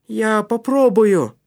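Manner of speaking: high, loud, slow resolve; bracing himself. A narrator's reading. Матиас agrees to try the narrow gap, determined. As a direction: force it out with determination. Boy braces to squeeze through.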